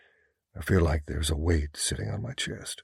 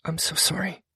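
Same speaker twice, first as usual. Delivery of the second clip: contrite woman; 11 semitones higher than usual. A narrator's reading. Female character, contrite.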